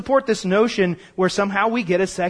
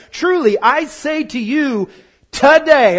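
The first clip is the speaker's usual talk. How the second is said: proclaiming; 4 semitones higher, 4 dB louder, slower than usual. Acted. Preacher quoting Jesus loudly.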